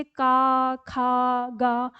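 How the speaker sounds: का खा गा sounded out with a long aa; demo with aa vowel. Shown as a demonstration. Teacher sounding out का, खा, गा for pupils. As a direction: sound out का, खा, गा slowly. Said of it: "आ की ध्वनि निकालिए".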